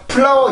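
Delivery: "플라워" with P for F and no R; wrong Korean-style pronunciation. Shown as a demonstration. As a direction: say "플라워" as plain Konglish syllables. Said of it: "이렇게 하면 절대로 안 됩니다". ("플라워"를 F와 R 없이 한국식으로 발음)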